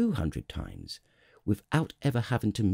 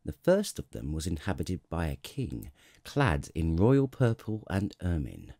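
ordinary delivery

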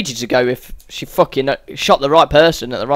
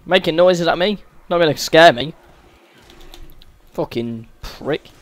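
ordinary talk

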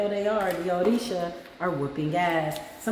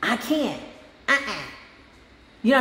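usual speaking voice

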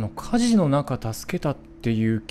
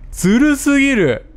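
indignant cry; 12 semitones higher, 10 dB louder than usual. Real, not acted. Complaining the enemy cheats.

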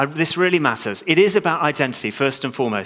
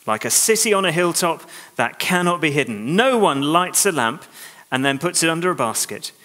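ordinary delivery

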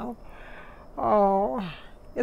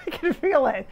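high laughing bursts of excitement; giggly excitement; 7 semitones higher, 3 dB louder than usual. Real, not acted. Psychic sensing a spirit arrive, laughing excitedly. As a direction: laugh as the feeling rushes in.

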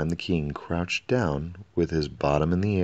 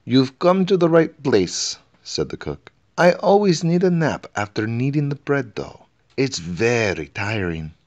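much higher, louder busy cook; cheery cook; 7 semitones higher, 7 dB louder than usual. A narrator's reading. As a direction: bustle it out as the palace cook. Cook voiced.

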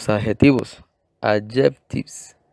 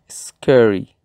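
"scary" with force on the "ca"; "scary" punched on its first syllable, then falling; stress on first syllable. Shown as a demonstration. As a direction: lean on the CA in "scary", curling the tongue for the R. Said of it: "se hace una acentuación o fuerza".

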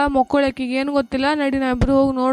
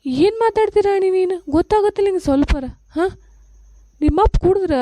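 higher, sharp rebuke of the son; exasperated scolding; 5 semitones higher than usual. Acted. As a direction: scold him for not understanding. Mother scolds son defending father. (ಸಿಟ್ಟಿನ ಗದರಿಕೆ)

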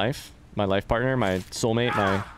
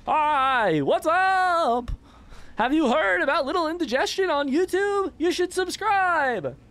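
much higher put-on voice, slowing on the last line; squeaky impression; slower than usual. Streamer mimicking a game character, high squeaky impression. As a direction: squeak it out like a tiny cartoon character. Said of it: "That was a solid impression of me".

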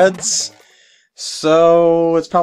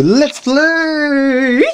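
excited; 10 semitones higher, 3 dB louder than usual. Pretend. Jingle ends with cheer.

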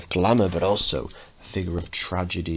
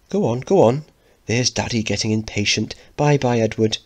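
higher, louder cheerful mum shooing him out; good-natured hustling; 4 semitones higher, 4 dB louder than usual. Acted. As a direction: chivvy him out with a laugh in the voice. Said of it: "hustling him out good-naturedly".

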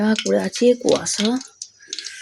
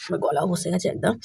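real hoarseness, softer and strained; hoarse voice; 5 dB quieter than usual. Real, not acted. Tired mother after sleepless nights, voice gone hoarse. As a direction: speak through a worn-out throat. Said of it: "গলা শুনেই বুঝতে পারছেন যে গলাটা কেমন বসে গেছে".

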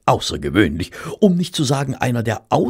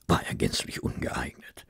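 dry whisper, much softer and lower; whispered verdict; 7 semitones lower, 10 dB quieter than usual. A narrator's reading. Narrator sharing a sly judgement of Holmes, whispering. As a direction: drop to a knowing whisper.

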